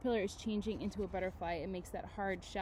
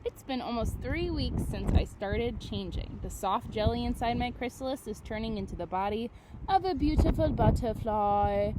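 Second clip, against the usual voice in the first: put-on reading to children; 7 dB louder than usual. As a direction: read it like a big picture book to the class. Reads book aloud to class.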